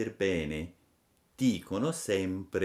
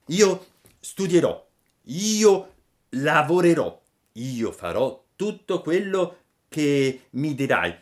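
earnest Pinocchio; 8 semitones higher, 9 dB louder than usual. Acted. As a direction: swear it with fervour. (Pinocchio che giura, alto e forte)

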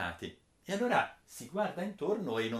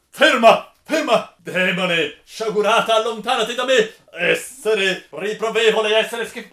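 high, very loud, disgusted yelling at a demon; furious shout; 8 semitones higher, 16 dB louder than usual. Acted. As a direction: drive her away screaming. Rinaldo repels Angelica.

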